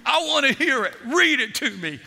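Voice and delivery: high, loud demand; acted-out insistent pastor; retold insistence. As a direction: press the order home, voice up. Pastor insists on hearing the letter.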